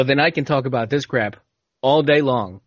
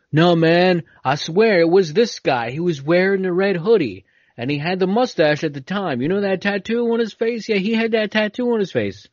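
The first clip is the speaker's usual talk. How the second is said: higher, pushy witness voice; insistent witness; 6 semitones higher than usual. Retold or imitated. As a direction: swear it was him, insisting. Acted witness insisting in court.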